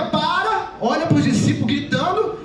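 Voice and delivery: usual speaking voice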